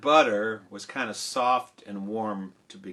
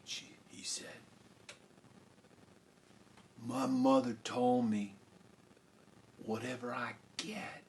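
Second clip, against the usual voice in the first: hushed recollection; 15 dB quieter than usual. Acted. Jack recalling his mother's instruction, hushed.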